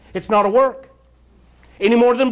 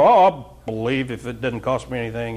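mock shallow believer; 8 semitones lower than usual. Mocking a shallow believer's attitude.

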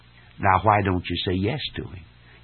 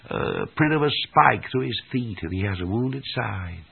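usual speaking voice

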